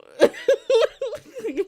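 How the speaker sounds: loud, high burst of laughing; helpless laughter. Real, not acted. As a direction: crack up at your own story. Cracking up at own story.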